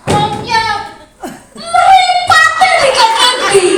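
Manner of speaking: very high, loud, stretched-out scolding; mock angry parent. Lecturer plays Indonesian parent scolding child, hammed-up yell. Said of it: "nggak dimarah-marahin".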